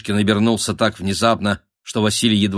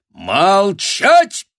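loud, raised bellow for silence; roared command; 11 semitones higher, 6 dB louder than usual. A narrator's reading. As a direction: roar the crowd into silence. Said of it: "Шишкин рявкнул". (грозный рявк)

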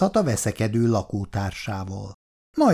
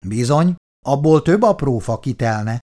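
louder, higher, weighty agreement on "Bizony"; emphatic; 4 semitones higher, 5 dB louder than usual. A narrator's reading. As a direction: press home "Bizony, abból" with emphasis. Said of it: "mondta nyomatékkal Szabó". (nyomatékkal mondott „Bizony”)

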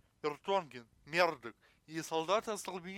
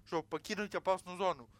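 ordinary delivery

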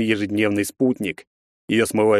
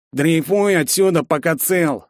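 higher, spiteful thug threat; menacing warning; 7 semitones higher, slower than usual. Acted. Redhead threatens the wheelchair boy, spiteful. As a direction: warn him off with spite. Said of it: "злобно зыркнув на меня".

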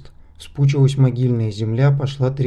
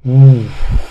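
a heavy, booming "uf" as the stone giant wakes; loud giant exhale; 9 dB louder than usual. A narrator's reading. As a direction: blow out a huge breath, like a waking boulder. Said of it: "выдохнул великан". (громкий выдох великана)

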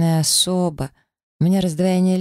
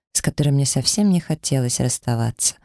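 ordinary delivery, nothing marked

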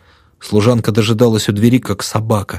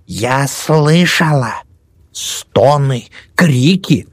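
put-on old woman voice; 6 semitones higher, much slower than usual. Old woman recounts horror.

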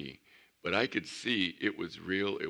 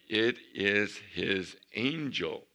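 slow emphasis; 2 semitones higher, 5 dB louder, much slower than usual. Real, not acted. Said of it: "slowed scripture quote".

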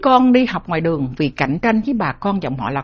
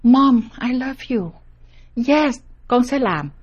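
slow grateful daughter; 5 semitones higher, slower than usual. Retold or imitated. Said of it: "Nó nhìn Ngọc Nhi".

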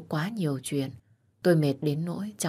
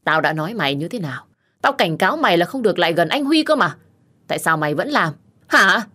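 furious yelling rant, louder; angry scolding; 7 dB louder than usual. Acted. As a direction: shout her down in a jealous rage. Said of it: "gây sự chửi mắng". (la mắng giận dữ)

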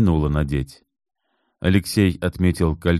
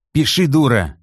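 higher, louder angry bark; barked order; 9 semitones higher, 4 dB louder than usual. Acted. Kit barking at Natalya to write, angry. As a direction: snap it at her like a threat. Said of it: "рявкнул Кит".

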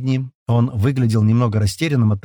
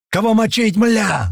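high-pitched eager yell; 11 semitones higher, slower than usual. A narrator's reading. Tomas among the newly arrived fighters, yelling. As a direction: bellow the question, pitch up high. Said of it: "заорал Томас".

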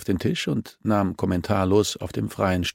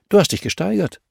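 sarcastic jab; 12 semitones higher, 6 dB louder than usual. A narrator's reading. Detective's sarcastic jab, much higher.

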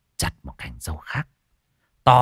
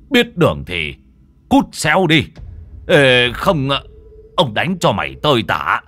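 much louder, higher, commanding; furious exorcist shout; 7 semitones higher, 7 dB louder than usual. Acted. Father banishing the foreign ghost, furious commanding shout. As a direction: thunder at the ghost to drive it out. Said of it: "đứng sừng sững ở giữa phòng mà quát".

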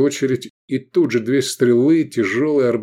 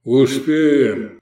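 brisk raised shout; a short, raised cry; 4 semitones higher, 5 dB louder than usual. A narrator's reading. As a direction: call it out louder and higher. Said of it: "крикнул Даврон".

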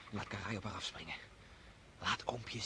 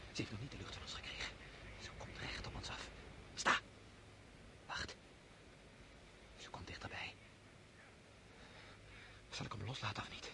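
hushed whisper, much softer; 7 dB quieter than usual. Acted. Hunter in ambush as the wolf nears, hushed whisper.

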